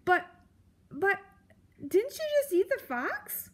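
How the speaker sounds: very high, hesitant squeak; falter, then blurt a high puzzled question; stammering mouse. A narrator's reading. Mouse puzzled about the fox, stammering.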